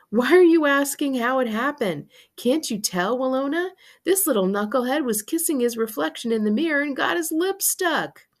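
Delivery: amused, disbelieving dad; exasperated dad. A narrator's reading. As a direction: spell out the obvious to your wife. Dad mocks his stuck son.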